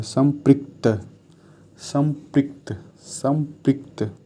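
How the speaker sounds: संपृक्त slowly, with a clear pri; slow careful pronunciation. Shown as a demonstration. Teacher repeating संपृक्त slowly for students. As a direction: drill संपृक्त slowly, ri sound distinct. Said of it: "संपर्कित नहीं संपृक्त होता है".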